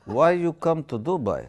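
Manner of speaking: a lightly put-on Russian accent, pleading; mock Russian accent, coaxing the woman home. [name] in a Russian accent tells Anastasia to return, lightly put-on Russian accent. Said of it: "Russian accent".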